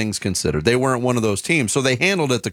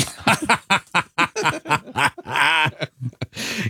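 laughing; 5 semitones higher than usual. Real, not acted. Laugh at joke.

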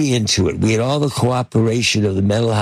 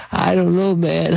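higher-pitched, uneasy hedging; retold wary protest; 7 semitones higher than usual. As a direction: voice lifted in half-joking doubt. Retold reluctance after being bitten.

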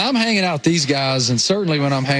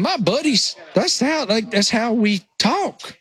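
higher, thick Appalachian accent; 8 semitones higher than usual. Real, not acted. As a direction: talk like the Appalachian buddies back home. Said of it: "rural Appalachian accent is".

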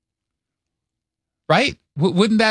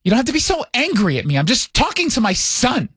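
quick, high, hurt New York mom impression; mother's New York accent; 5 semitones higher than usual. Mother protests to son, high aggrieved New York accent. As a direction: imitate an affronted New York mother, pitched up. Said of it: "talks like this all the time".

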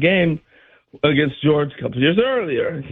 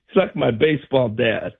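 usual speaking voice

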